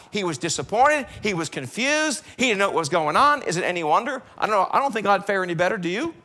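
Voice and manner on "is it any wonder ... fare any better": ordinary talk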